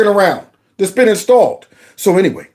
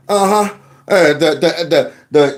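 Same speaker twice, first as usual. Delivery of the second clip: usual speaking voice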